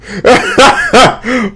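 high, loud laugh; real amused cackle; burst of laughter. Real, not acted. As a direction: crack up laughing at the joke. Reacting to a funny tweet.

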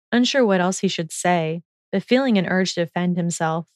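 ordinary delivery

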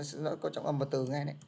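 ordinary talk

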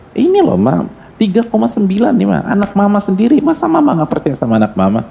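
high, whiny plea to Mama; pleading son. Acted. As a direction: beg Mama like a hurt grown child. Son pleading with his mother.

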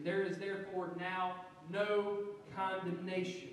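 no leaned on, emphatic; the no pressed home, emphatic; stress on no. Shown as a demonstration. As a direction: hit the word no hard. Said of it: "The next word is no. Uh, this in the Greek is very emphatic".

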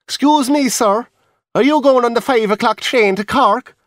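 a high chatty dancer calling down; high-pitched chatty Irish dancer; reel dancer. Acted. Dancer wakes narrator.

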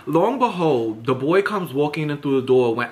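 usual speaking voice

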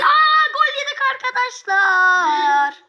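high gasp, then slow dismay over the goal; a sudden "Aa" as the goal goes in, then let it sink in slowly. Real, not acted. Team concedes a goal. (yüksek bir şaşkınlık sesi, ardından yavaş bir hayal kırıklığı)

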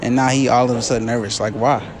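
normal talking voice

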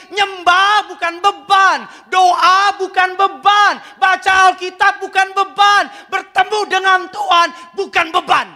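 fiery sermon proclamation; forceful preaching. Real, not acted. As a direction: hammer home each "bukan beban".

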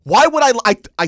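high, loud, slow angry shout; furious retold shout; retold yelling. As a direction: yell it at dad. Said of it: "I went".

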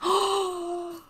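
high sharp gasp of delight; excited gasp. Real, not acted. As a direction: gasp in happy disbelief. Notices no floor lines.

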